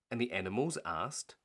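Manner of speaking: plain reading voice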